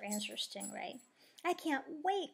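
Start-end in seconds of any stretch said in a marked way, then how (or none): 1.4-2.3 higher, eager exclamation; excited cry. A narrator's reading. Plastic bursting with eagerness for the beach, excited cry. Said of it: "cries Plastic".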